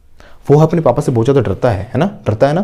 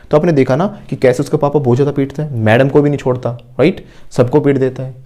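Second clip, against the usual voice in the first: normal talking voice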